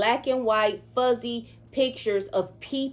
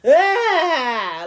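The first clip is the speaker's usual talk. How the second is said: sharp high wailing cry bursting out; anguished cry; 9 semitones higher, 9 dB louder than usual. Retold or imitated. Recalling her dream, she cries out loud. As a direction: let out one loud sobbing wail. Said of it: "to where the cry was".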